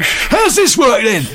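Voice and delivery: high, loud, drawn-out yell; huge excitable bellow; hyperactive shouting. Retold or imitated. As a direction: shout it at people like an over-excited presenter. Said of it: "shouting at people".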